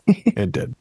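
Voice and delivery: ordinary delivery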